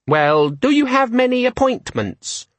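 a tiny demon selling its features; high, eager electronic assistant; chirpy gadget. A narrator's reading. Disorganizer voice.

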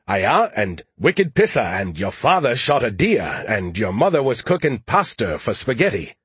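exaggerated Maine accent, R's dropped; mock a thick northern New England accent; imitated Maine accent. Skippy mimics Joe's family talk, mock Maine accent. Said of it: "you tone down your accent around most people".

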